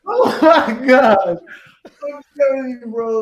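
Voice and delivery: drawn-out laughing oh my God; real laughter breaking through, slow and loud. Real, not acted. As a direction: crack up while you say it. Cracking up at the memory.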